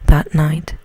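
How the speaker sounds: plain reading voice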